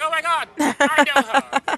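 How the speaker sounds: loud, slow, thrilled exclaim; excited squeal. Retold or imitated. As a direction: squeal out the recognition. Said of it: "I was like".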